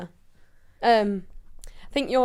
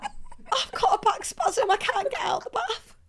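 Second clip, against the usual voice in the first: very high, slow mock whimper; imitated pained wail; 11 semitones higher, slower than usual. Imitating a teammate stuck in the bath, mock-pained wail. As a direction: squeal it helplessly, hammed-up and drawn out.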